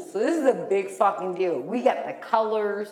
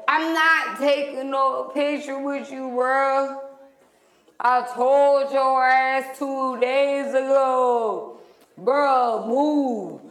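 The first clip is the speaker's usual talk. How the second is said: louder, slow and emphatic, higher; firm telling-off; 6 semitones higher, 4 dB louder, slower than usual. Retold or imitated. Girl ordering her date to move away, louder and emphatic. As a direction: lay it down slowly, no arguing.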